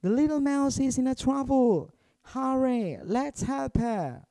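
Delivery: high, urgent rallying cry; Mister Mouse rallying his friends in alarm; urgent call. Acted. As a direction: hurry them up, alarmed.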